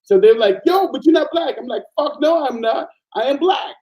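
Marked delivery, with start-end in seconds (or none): 0.6-1.6 much higher, teasing disbelief; mock challenge. Spanish people challenging his identity. 2.0-3.7 much higher, indignant insistence; retold retort.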